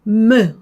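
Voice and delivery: 무 loud with a full vowel; strong eu vowel. Shown as a demonstration. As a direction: say 무 heavily, vowel sounded. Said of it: "무 이렇게 했다면".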